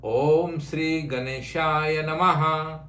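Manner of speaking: Om Sri Ganeshaya Namaha, slow and even, each syllable drawn out; teacher slowly leading a mantra for the student to echo; slow mantra recital. Shown as a demonstration. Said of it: "Say it".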